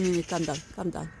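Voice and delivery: normal talking voice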